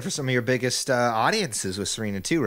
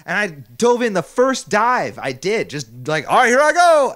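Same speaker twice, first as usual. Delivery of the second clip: excited; 6 semitones higher, 7 dB louder than usual. Retold or imitated. Retelling the dive, louder.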